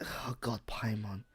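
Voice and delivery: whispering. Real, not acted. Whispered dread before impression.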